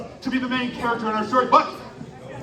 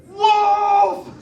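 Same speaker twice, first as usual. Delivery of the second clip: loud, high shout of the word; group shout; 11 semitones higher, 8 dB louder than usual. Real, not acted. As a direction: yell it out to the audience. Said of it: "we have to shout your choice really loud".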